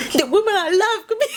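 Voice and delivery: a high, laughing parody of the line; laughing mock-dramatic imitation; mocking cheesy hero. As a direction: ham up the romantic line to tease it. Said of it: "He's so cheesy".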